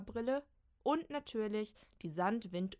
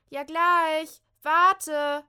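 high, very loud shout; 9 semitones higher, 17 dB louder, much slower than usual. Acted. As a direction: yell up the stairs. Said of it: "ruft Kim von unten". (laut nach oben rufen)

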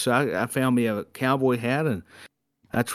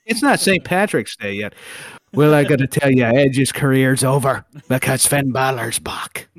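put-on Irish accent, pushed louder; 4 dB louder than usual. Commentator mocking a call in an Irish accent. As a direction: play an Irish commentator hyping Finn Balor. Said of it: "That was brilliant".

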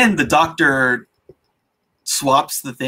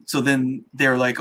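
ordinary talk, nothing marked

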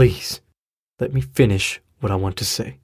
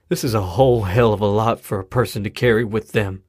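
ordinary talk, nothing marked

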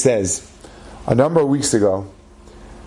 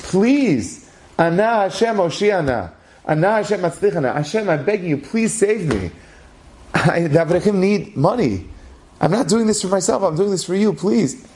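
pleading prayer; 7 semitones higher than usual. Acted. Rabbi alone in his office begging Hashem for money, pleading high. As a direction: plead with God as if everything depends on it.

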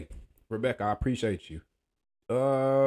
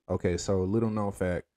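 usual speaking voice

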